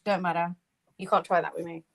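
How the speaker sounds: ordinary delivery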